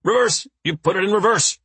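high urgent words through teeth; through gritted teeth. A narrator's reading. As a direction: force it through your teeth. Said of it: "through his teeth".

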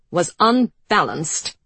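slow, cold and controlled. Acted. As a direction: hold the anger under control. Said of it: "Her voice was controlled".

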